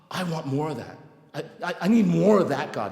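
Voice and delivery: awed want building to a loud demand; eager craving. Retold or imitated. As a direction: start in wonder, swell into urgent wanting. Awed, eager plea to sponsor.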